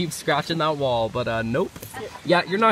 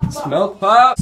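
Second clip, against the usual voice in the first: calling out; 3 semitones higher, 7 dB louder than usual. Real, not acted. Calling out the dog's name.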